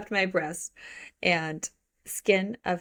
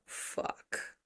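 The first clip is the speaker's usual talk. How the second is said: unvoiced, much softer curse; breathy lustful curse; 13 dB quieter than usual. Book hero overcome by the woman he loves, hushed lustful curse. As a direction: let it out almost as a whisper. Reads hero's lustful exclamation aloud.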